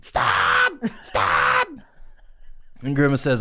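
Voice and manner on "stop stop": shouted, second one very high; hammed-up begging yell; mock pleading shout. Q begging Grimace to stop.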